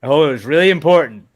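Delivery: loud mock outrage; loud sarcastic jab. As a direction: scold him sarcastically. Mocking co-host for texting.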